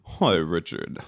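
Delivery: a hint of Frasier Crane's stuffy diction. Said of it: "we're both gonna sound like, uh, Fraser Crane".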